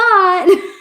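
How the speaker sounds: high, loud burst of laughter; loud short laugh. Real, not acted. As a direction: break into a laugh. Laughing after "but.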